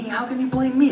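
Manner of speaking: high-pitched innocent plea; defensive protest. Acted. Boy insisting dad can't blame him, high defensive protest.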